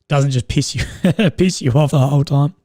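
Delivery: ordinary delivery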